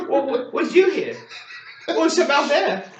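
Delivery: high, loud mock-baffled questions; feigned innocence. Covering up the fight to Mom, feigned innocence.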